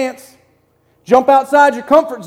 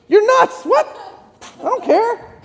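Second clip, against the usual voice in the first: much higher, scoffing brush-off; dismissive coworker; 10 semitones higher, slower than usual. Pretend. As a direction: wave the apology off as nonsense. Coworker's scoffing reply, acted.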